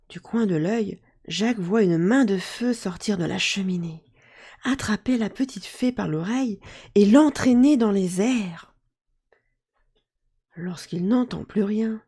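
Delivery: ordinary delivery, nothing marked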